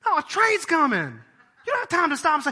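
high, loud insistent warning; urgent shout. Pretend. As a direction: cut them off, there's no time.

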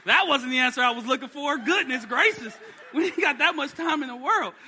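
laughing exasperation. Real, not acted. Exasperated parent reply, laughing.

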